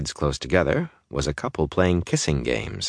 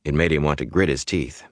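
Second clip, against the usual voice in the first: usual speaking voice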